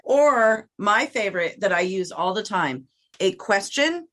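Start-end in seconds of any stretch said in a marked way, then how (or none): 3.2-4.1 rising question tune. Shown as a demonstration. Voice rising on question to show dog owners. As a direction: lift the end of question up. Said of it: "your voice goes up".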